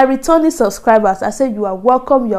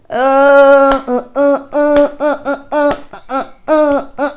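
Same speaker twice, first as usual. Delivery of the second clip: panicked stammer; 4 semitones higher, much slower than usual. Acted. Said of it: "panicking at teachers".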